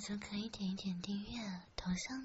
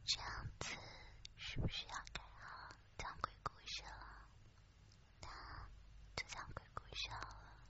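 airy ghostly whisper; ethereal whisper; 10 dB quieter than usual. Real, not acted. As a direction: breathe it out like a ghost story. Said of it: "妹子的声音很空灵".

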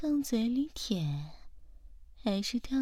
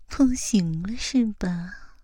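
usual speaking voice

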